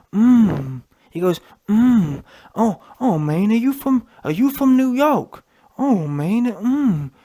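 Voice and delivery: mock a gushing interviewer who hums along; a hint of an eager, cooing fan; fruity interviewer impression. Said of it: "Listen to how fruity the interviewer was".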